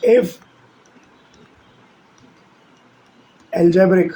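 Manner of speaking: each word spoken slowly with long gaps for writing; measured, deliberate note-taking dictation. Shown as a demonstration. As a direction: dictate it word by word so students can copy. Teacher dictates a definition for notes.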